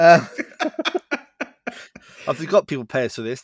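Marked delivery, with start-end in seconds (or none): 0.3-2.2 high burst of laughter. Real, not acted. Shocked laugh about being paid.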